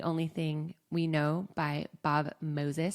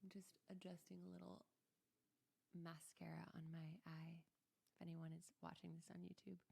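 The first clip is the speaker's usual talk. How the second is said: much softer, quick half-whispered remark; whispered aside; 23 dB quieter than usual. Real, not acted. To YouTube viewers while fixing mascara, whispered. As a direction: mutter it off to the side, fast.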